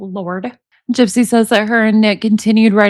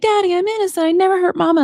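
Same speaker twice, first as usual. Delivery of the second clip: put-on higher, childish plea of innocence; mock little girl voice; 8 semitones higher, faster than usual. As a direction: whine it like a little girl begging daddy. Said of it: "she tells about Colin and being like".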